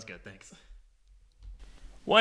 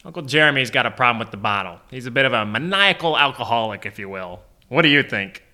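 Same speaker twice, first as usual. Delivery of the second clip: fast, rattled-off fatherly warning; hurried dad; faster than usual. Retold or imitated. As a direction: rush through the bad news about the uncle. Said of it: "and he goes".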